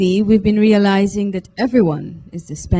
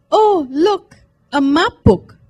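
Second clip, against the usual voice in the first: high, loud delighted cry; thrilled at finding the map book; excited exclaim; 10 semitones higher, 6 dB louder, slower than usual. Acted. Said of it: "Fiona exclaimed".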